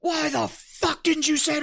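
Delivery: high, slow, drawn-out angry demand; slow mock-angry drill sergeant question. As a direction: drag it out like a furious sergeant. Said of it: "He walks".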